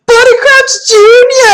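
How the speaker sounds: loud, high, drawn-out shout at the screen; excited shout. Retold or imitated. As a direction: yell the name like a fan. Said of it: "we'd all shout a character name".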